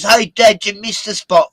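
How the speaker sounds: put-on high, loud parody of the host's son in an Australian accent; mock Aussie kid. Quoting the radio host's son, in a high, loud mock Australian accent. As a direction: tease like a cheeky Australian son. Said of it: "And then my son says".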